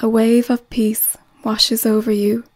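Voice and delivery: ordinary delivery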